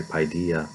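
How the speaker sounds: "dee" in the middle of paidia; paidia, not paideia. Shown as a demonstration. Lecturer giving the Greek word for play, paidia with "dee". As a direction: say paidia with an ee sound. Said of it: "not to be confused with".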